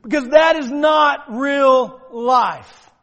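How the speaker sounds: loud, slow and weighty; slow forceful declaration. Real, not acted. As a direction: hammer home the truth. Preacher's climactic point.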